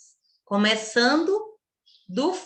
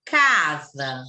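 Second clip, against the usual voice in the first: "Casa" with a strong, stretched CA and a weak sa; stronger first syllable; 6 semitones lower, 3 dB louder than usual. Shown as a demonstration. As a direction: punch the CA of "Casa", let sa drop. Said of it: "foi pronunciada mais fortemente".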